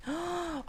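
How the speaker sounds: sharp high gasp of surprise. Real, not acted. Vlogger opening her old letter, sharp surprised gasp.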